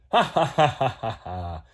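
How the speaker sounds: a hammy laugh dying away; acted laugh. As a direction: laugh at your own prank. Prankster laughs at friends.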